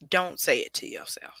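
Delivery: soft unvoiced whisper. Real, not acted. Quietly telling the viewer not to say it to themselves, whispered. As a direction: breathe the advice out as a whisper. Advice line dropped to whisper.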